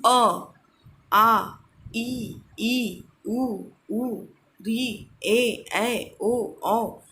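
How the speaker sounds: each vowel drawn out clearly for the class; "अ", "आ", "ई" sounded one by one, open and unhurried; vowels said aloud slowly. Shown as a demonstration. Teacher shows the class the free-flowing vowels. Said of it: "जैसे देखिए".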